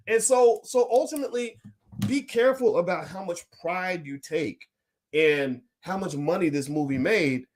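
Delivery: usual speaking voice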